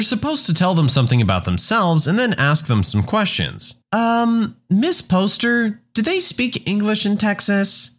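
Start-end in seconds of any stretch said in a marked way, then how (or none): 3.9-7.9 put-on high, hesitant little kid voice; higher-pitched puzzled schoolkid; young pupil. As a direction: ask teacher slowly in a high child's voice. Second grader asks teacher a question.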